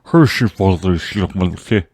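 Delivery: hammed-up mush-mouth version of the clip; mock marble mouth. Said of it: "we call that a, a marble mouth".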